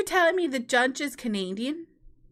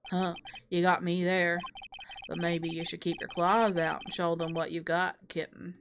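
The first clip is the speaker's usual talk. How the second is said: low, softer teasing rival voice; cocky prosecutor; 6 semitones lower, 4 dB quieter than usual. Acted. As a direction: purr the taunt down low. Rival taunts Mia again.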